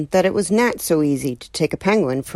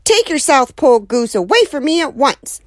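indignant neighbor; 8 semitones higher than usual. Acted. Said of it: "said Missus Callahan".

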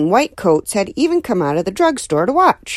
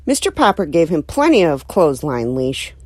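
usual speaking voice

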